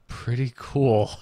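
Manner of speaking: ordinary talk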